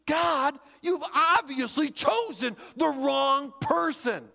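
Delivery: high, pleading objection to God; acted self-doubting protest.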